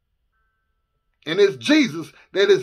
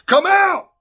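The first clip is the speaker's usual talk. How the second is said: loud, high command with authority; Jesus; 8 semitones higher, 5 dB louder than usual. Retold or imitated. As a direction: fire it out as an absolute order. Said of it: "see the authority when Jesus said".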